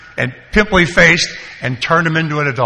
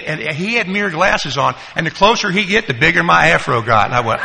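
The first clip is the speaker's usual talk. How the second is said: ordinary delivery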